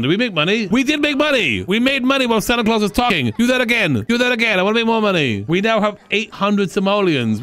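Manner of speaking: high-pitched, thrilled exclaiming; excited. Real, not acted. Player finding the act made money, excited. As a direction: get giddy over the earnings.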